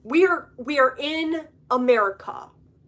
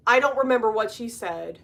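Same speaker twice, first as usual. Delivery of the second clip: ordinary talk, nothing marked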